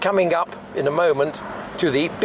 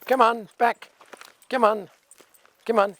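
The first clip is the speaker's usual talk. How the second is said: coaxing; 7 semitones higher than usual. Real, not acted. Coaxing the dog back.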